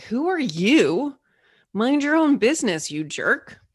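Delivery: an irritated squirrel telling off a stranger; annoyed squirrel. Pretend. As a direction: squirrel snapping at the bird who called down to it, annoyed. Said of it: "is like".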